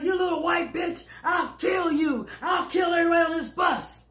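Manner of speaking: high mock shout; retold threat to kill. Big woman threatening everyone on the bus, mock shout. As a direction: yell it at the whole bus.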